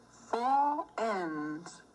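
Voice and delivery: plain reading voice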